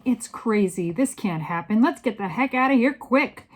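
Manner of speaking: strong men shouting in alarm; panicked shouting. A narrator's reading. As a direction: shout building to a high, loud finish.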